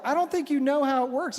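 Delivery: mock mansplaining. Husband correcting his wife on her own religion, mansplaining. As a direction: explain it to her as if you know better. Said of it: "I start mansplaining to my wife".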